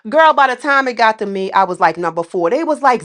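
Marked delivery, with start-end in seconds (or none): none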